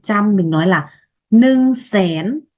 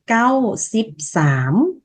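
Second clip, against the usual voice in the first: Lao number repeated slowly and clearly; slow model reading; much slower than usual. Shown as a demonstration. Teacher repeats the number slowly so learners follow. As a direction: take it slow, syllable by syllable. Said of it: "Sám hôi".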